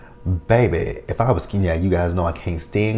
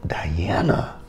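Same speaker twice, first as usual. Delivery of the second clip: hushed warning whisper; whispered rising aside; whispered name. Real, not acted. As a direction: breathe the name like a threat. Ominous aside about character.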